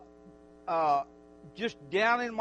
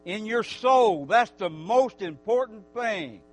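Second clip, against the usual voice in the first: loud, fervent preacher voice; preaching; 9 dB louder than usual. Acted. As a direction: preach it out to the whole church. Preaching to the congregation.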